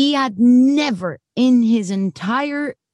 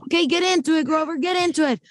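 director's pep count; 9 semitones higher than usual. Retold or imitated. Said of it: "And so I was like".

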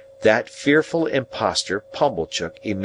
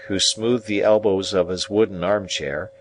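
ordinary delivery

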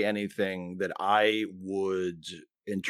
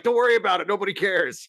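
mock dismissive producer; 9 semitones higher, 4 dB louder than usual. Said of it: "And they're like".

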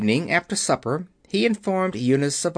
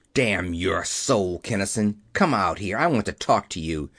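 angry roar; 7 semitones lower than usual. A narrator's reading. Keller calls Kinnison out into the corridor, roaring angrily. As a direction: roar it low in the throat, held back in level. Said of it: "Keller roared".

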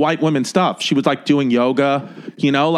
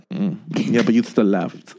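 put-on a touch lower, sulky little girl; lightly voiced toddler daughter; 8 semitones lower, slower than usual. To her dad, a mildly sulky toddler voice. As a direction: pout it out like a toddler. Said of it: "she was like".

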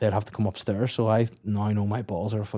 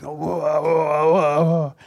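mock grunting; 5 semitones higher, 5 dB louder, much slower than usual. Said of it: "I was fucking spitting at the mouth".

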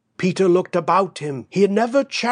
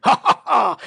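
high, louder diabolical laugh; roaring laugh; 7 semitones higher, 4 dB louder, faster than usual. Acted. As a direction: roar with devilish laughter. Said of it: "he roared".

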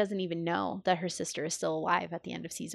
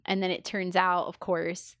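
normal talking voice

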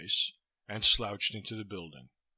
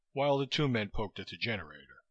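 ordinary delivery, nothing marked

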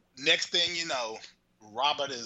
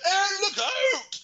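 high, slow, louder cry of alarm; hammed-up panicked shout from the driver's seat; mock warning shout; 5 dB louder than usual. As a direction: yell a warning in a pitched-up voice. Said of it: "and he's like".

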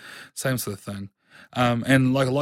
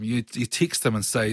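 ordinary talk, nothing marked

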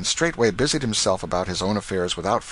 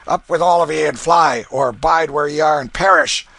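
louder, higher hoarse order; hoarse command; 7 semitones higher, 8 dB louder than usual. Acted. Canty rousing his family to flee, hoarse and loud. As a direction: bark the family out of bed. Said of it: "hoarsely commanded".

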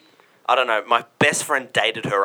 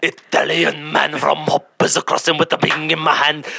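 high, exuberant mock-Italian accent; broad comic Italian accent, swooping pitch; Italian man; 8 semitones higher, 6 dB louder than usual. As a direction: play a loud, hammed-up Italian showman. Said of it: "do some sort of Italian man from Werribee".

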